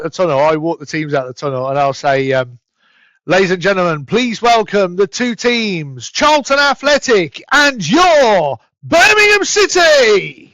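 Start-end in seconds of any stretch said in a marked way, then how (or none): 3.3-10.5 slow, loud, high stadium tannoy call; stadium announcer. Shown as a demonstration. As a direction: boom it out to the whole stadium as the teams walk out. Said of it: "give us a statement or some sort of an announcement".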